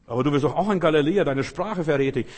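fast loud accusing challenge; accusing maid. Acted. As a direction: call him out fast and loud.

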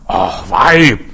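loud, stern dismissal of the woman; stern rebuke. Retold or imitated. Jesus turning the foreign woman away, loud and stern. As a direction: boom it out as a harsh refusal. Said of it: "hat Jesus gesagt".

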